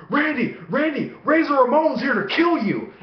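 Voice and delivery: high-pitched urgent wake-up call; dad's mock alarm. Dad waking his kid with a prank warning, mock alarm. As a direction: imitate dad raising a playful false alarm.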